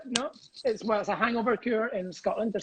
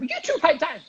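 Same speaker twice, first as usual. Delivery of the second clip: mock teacher; 8 semitones higher, 4 dB louder than usual. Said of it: "I felt like a teacher".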